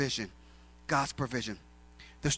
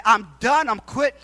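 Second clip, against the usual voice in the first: loud, higher, defiant announcement; acted quitting; 6 semitones higher, 8 dB louder than usual. Retelling walking into the Y to quit, loud declaration. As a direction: act out a fed-up resignation. Acts out quitting his job.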